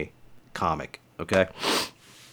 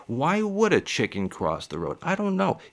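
puzzled friend; 7 semitones higher than usual. Retold or imitated. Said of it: "every one of them will be like".

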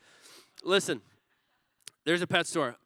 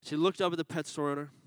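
usual speaking voice

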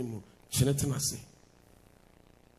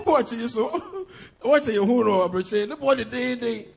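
excited; 7 semitones higher, 7 dB louder than usual. Real, not acted. Excited about cheap watch.

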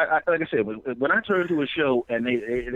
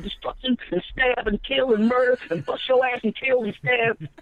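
higher, fast, hammed-up threat piling on violent words; mock LA gangster threat; 9 semitones higher than usual. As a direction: rattle off the threats like a parody thug. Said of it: "the gang members that used to be threatening".

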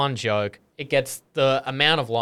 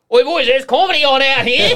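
loud, high laddish call across the room; rowdy rugby lad; 13 semitones higher, 8 dB louder than usual. Retold or imitated. Young rugby player summoning his team, loud rowdy holler. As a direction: holler to your mates, half laughing.

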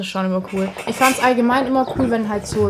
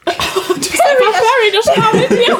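laughing; 13 semitones higher, 8 dB louder, slower than usual. Retold or imitated. Quoting a cartoon line laughing.

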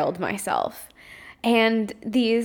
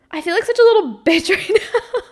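higher and louder, breaking into a laugh mid-line; laughing at herself; 10 semitones higher, 6 dB louder than usual. Real, not acted. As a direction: laugh through the self-insult. Self-deprecating laughing outburst.

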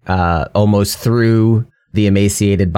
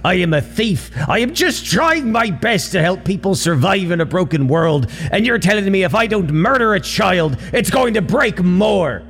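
higher, pleading voice full of despair; anguished protest; 9 semitones higher than usual. Acted. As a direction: cry out against an impossible choice, voice strained high. Bottle Brew despairs at killing child.

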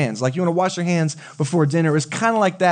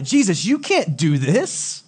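mock outraged protest; 3 semitones higher, slower than usual. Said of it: "They're probably outraged".

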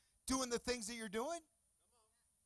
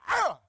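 battle cry shout; 8 semitones higher, 16 dB louder than usual. Acted. Marine assault battle cry.

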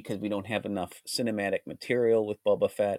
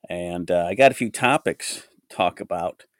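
ordinary talk

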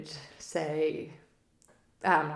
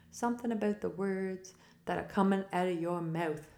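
movie quote; 2 semitones higher, 4 dB quieter than usual. Retold or imitated. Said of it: "I didn't do a good job of that quote".

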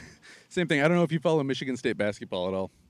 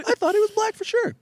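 much higher, airy squeal of surprise; high mock disbelief. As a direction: squeak it like you cannot believe it. Own retold surprise, voiced.

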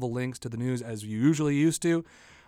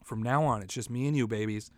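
ordinary talk, nothing marked

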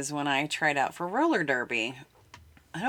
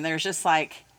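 normal talking voice

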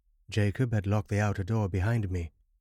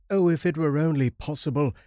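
high, louder cry of longing; eager outburst; 7 semitones higher, 6 dB louder than usual. A narrator's reading. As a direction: burst out, hoping against hope. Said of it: "starting eagerly to my feet".

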